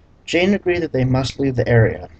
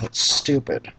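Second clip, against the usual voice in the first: whispered aside; 4 dB quieter than usual. Real, not acted. Muttered aside on the book.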